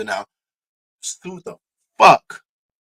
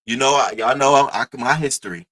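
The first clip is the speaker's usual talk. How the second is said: normal talking voice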